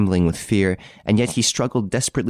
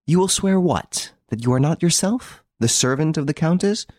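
much higher, lightly voiced taunting interrogator; mocking detective; 10 semitones higher than usual. Ex-inspector mocking Victor's denial, light taunting voice. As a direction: toss his denial back at him.

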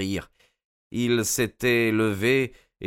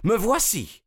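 much higher woman's voice, proud; woman stepping out; 13 semitones higher, 3 dB louder than usual. Acted. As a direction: announce yourself boldly. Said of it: "s'écria-t-elle avec un accent étranger".

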